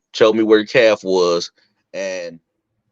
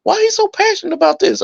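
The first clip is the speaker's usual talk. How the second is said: mock baffled high voice. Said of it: "looking at me like".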